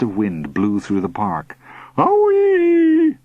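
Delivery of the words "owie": loud, very high whoop; flying yell. A narrator's reading. Uncle swept up by wind, yelling. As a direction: yell as the wind takes you.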